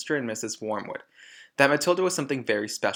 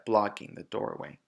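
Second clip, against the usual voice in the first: ordinary delivery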